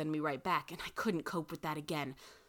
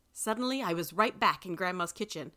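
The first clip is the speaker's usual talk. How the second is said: usual speaking voice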